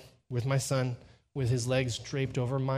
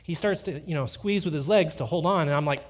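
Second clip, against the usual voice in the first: ordinary talk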